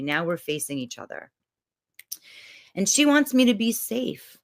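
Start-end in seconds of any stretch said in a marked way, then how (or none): none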